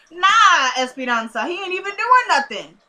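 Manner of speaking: high, loud mocking protest; worked-up, emphatic rebuttal; indignant objection. Real, not acted. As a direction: call her out with swooping pitch. Ranting at the character's false claim.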